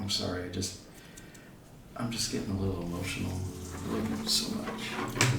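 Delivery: soft, halting, emotional apology; acted choked-up murmur, trailing into breath; a little tearful. Game master as the man confessing love, acting quietly tearful. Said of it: "Sounds like he might be crying a little".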